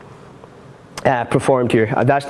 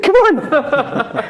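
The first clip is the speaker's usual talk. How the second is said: much higher, laughing through it; 13 semitones higher than usual. Real, not acted. Joking to viewer to break his pen, laughing. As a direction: giggle out a mock plea.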